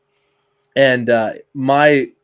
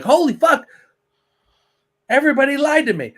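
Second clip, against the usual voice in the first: high, excited mock outrage; mock shocked outburst; 13 semitones higher, faster than usual. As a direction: act stunned by a family secret. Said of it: "you could be like".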